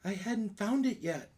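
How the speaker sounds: high, slow character voice; Lulu. Acted. Game master answering the party as a character, high-voiced. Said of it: "Lulu, do you know how to get in here?".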